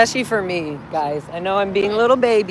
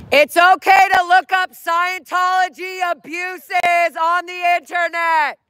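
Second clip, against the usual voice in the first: high, loud, drawn-out street callout; projected high-pitched protest yell; protest shout; 11 semitones higher, 4 dB louder, slower than usual. Real, not acted. Shouts protest messages at passers-by.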